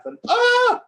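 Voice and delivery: high, loud startled shriek; sudden scream. Acted. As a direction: cry out as if stepping on a snake. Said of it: "the servants hear him scream".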